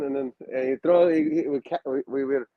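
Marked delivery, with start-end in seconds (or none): none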